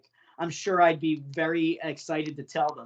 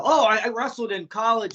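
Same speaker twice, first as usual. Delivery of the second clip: mock eagerness; 5 semitones higher, 4 dB louder than usual. Mimicking stranger boasting about wrestling.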